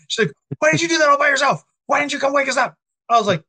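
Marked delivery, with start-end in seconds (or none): 0.6-2.7 high, fast mock scolding; retold indignation, pitched way up; imitated mad scolding. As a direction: mimic an upset bride pelting out questions.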